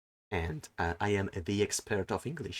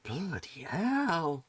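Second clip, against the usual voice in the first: slow exasperated groan; 5 semitones higher, much slower than usual. Retold or imitated. Reacting to streamers' bad English with a slow groan. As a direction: let it sag out in dismay. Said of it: "and I'm like".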